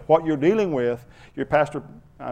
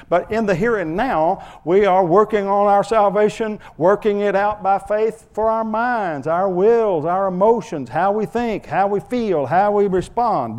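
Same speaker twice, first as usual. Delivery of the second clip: higher, louder, slow fervent preaching; preaching at full force; 7 semitones higher, 6 dB louder, slower than usual. Acted. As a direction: build it like a revival preacher.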